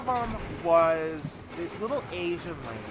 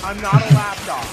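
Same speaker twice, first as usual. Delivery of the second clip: loud, slow, emphatic refusal; mock indignant declaration; acted firm protest; 11 dB louder than usual. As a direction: put your foot down, loudly and slowly. Acts designer standing up to bride.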